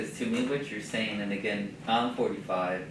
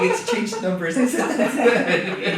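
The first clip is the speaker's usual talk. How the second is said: laughing; 9 semitones higher, 7 dB louder than usual. Real, not acted. Laughing end of story.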